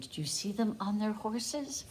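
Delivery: ordinary delivery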